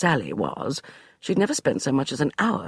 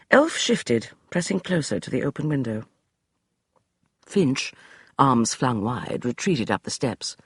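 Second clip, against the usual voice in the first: ordinary delivery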